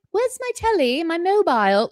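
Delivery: high-pitched mock British accent. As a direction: put on an exaggerated British accent. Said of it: "all of a sudden had a British".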